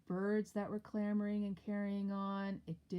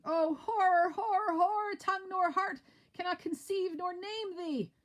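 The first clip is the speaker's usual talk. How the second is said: loud, high cries of horror; screaming in horror; 13 semitones higher, 8 dB louder than usual. Acted. Macduff screaming to the house in horror. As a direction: scream it out in shock. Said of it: "the screaming".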